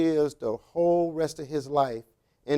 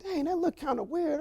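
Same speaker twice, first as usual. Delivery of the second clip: high, puzzled, soft thought; a baffled young guard thinking aloud; puzzled inner thought; 12 semitones higher, 7 dB quieter than usual. Retold or imitated. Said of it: "So I'm like".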